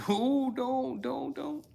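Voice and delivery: very high, sing-song "don't"; God calling out. Acted. Imitating God's unheard warning, high mock calling voice. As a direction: hoot it out high and drawn out. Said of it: "we can't hear God say".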